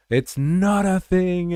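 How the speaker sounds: not stretched high and loud; stress on not. Real, not acted. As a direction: appuie fort sur not, lent et aigu. Insisting it's not a thing.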